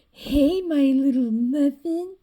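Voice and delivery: put-on a boyfriend loudly sweet-talking his girl; loud cooing lover. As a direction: call out to your little muffin, dragging it. Voicing characters in a drawing.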